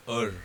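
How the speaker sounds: "er" with the R clearly sounded; voiced final R. Shown as a demonstration. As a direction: let the R be heard at the end of "er". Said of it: "we voice the consonant".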